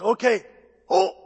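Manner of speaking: loud mock instructor's call; imitated taekwondo teacher's shout; martial arts command. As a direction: call the class to order, loudly.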